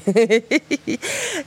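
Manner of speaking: high, falling chuckle; a quick laugh at the absurdity; short laugh. Real, not acted.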